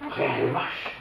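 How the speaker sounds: whispered disbelief. Retold or imitated. Horrified at milking cows.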